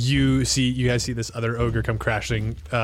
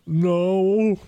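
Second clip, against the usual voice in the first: higher put-on ogre voice, sulking; sulky ogre; 7 semitones higher, 3 dB louder than usual. Ogre answering the party, sulky. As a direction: pout it out like a sad giant.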